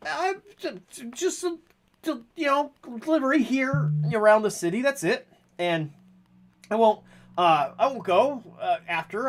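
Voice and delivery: high-pitched, stammering excuses; flustered pleading character. Acted. Character promises to stay and appeals to Kelly, high and flustered. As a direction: plead in a high voice, tripping over words.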